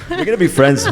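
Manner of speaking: playful laughing banter. Real, not acted. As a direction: giggle through the line. Joking banter among panelists.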